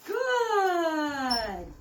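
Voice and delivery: high, loud "Good." to the dog; praise. Real, not acted. Trainer praising the dog, high and loud. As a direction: sing out the praise to reward the dog.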